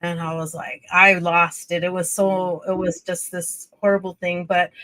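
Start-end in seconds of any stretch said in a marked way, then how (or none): none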